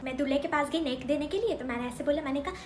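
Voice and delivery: ordinary talk, nothing marked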